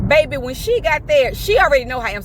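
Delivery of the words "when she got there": ordinary talk, nothing marked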